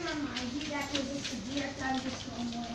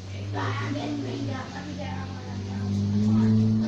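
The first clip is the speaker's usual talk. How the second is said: very low, loud play voice; deep put-on voice; 9 dB louder than usual. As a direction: drop way down, talk loud. Caller in storm, put-on voice.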